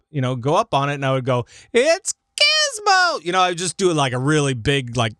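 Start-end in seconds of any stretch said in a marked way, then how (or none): 1.7-3.2 screechy, drawn-out high gnome cry; very high, slow screech; loud gnome voice. Retold or imitated. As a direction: stretch it out like a shrieking gnome announcer. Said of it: "like, a loud gnome voice".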